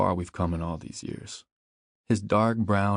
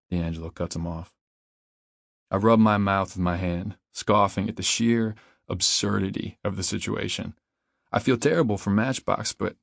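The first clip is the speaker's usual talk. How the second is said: ordinary delivery, nothing marked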